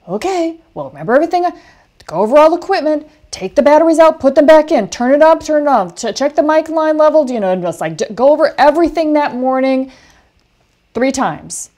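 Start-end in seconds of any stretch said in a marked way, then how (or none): 0.1-7.3 high, loud, fast rundown of the checklist; urgent coaching. Retold or imitated. Speaker drilling Marla before her first shoot, urgent and fast. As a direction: rattle off the instructions to calm a beginner. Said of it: "I'm like".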